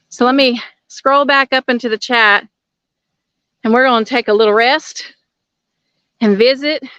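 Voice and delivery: winded from the uphill walk; out of breath. Real, not acted. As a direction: talk while catching your breath. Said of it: "sorry for breathing hard".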